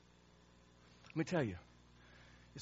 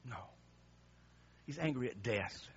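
ordinary delivery

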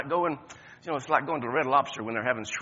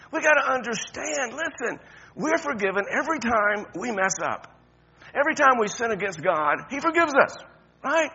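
ordinary talk, nothing marked

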